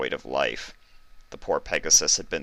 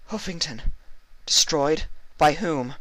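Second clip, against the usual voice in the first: high, shocked questions; 11 semitones higher than usual. Acted. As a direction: ask it stunned. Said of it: "Celestia asked in shock".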